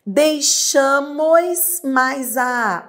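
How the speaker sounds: "Deixamos mais a" very slow; slow demo. Shown as a demonstration. Teacher reads the exercise item, very slow. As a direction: spell out "Deixamos mais a" word by word.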